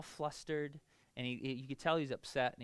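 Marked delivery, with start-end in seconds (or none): none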